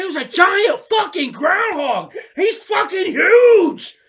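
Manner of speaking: high, loud, slow shouted exclamation; excited shout. Retold or imitated. Telling his wife about the giant groundhog, a slow loud shout. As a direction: stretch it out in disbelief.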